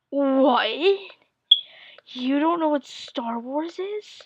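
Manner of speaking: mock outrage from a startled dad; shocked disbelief. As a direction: act floored that she's never heard of it. Dad shocked she doesn't know.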